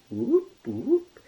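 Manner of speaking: high loud whooping; whoop sound. Retold or imitated. Describing the marble machine, high loud whoops. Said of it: "it goes whoop".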